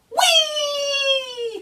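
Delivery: very high, long gleeful whoop; gleeful whee. Acted. Rabbit whoops skiing down the banister, very high. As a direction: whoop like sliding at speed. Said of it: "cried Rabbit as he shot past".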